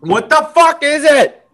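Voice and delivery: high, loud disbelief; loud outraged question. Real, not acted. Host baffled by a fake word, loud and high. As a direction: bark it out in shock.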